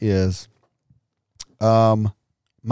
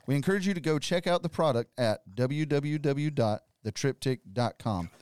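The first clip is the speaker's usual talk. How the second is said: ad read; 10 dB quieter than usual. Acted. Reads sponsor ad.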